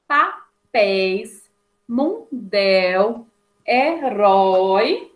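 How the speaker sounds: "papéis, mundel, herói" slowly split into syllables; careful dictation, a gap inside "papéis" and "herói". Shown as a demonstration. Teacher dictating words from the exercise.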